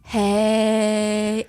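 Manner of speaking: slow it down like a grandpa; drawn-out hey. Retold or imitated. Imitating grandpa's slow speech, a drawn-out hey. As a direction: drag the hey out long. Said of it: "and you drag it on".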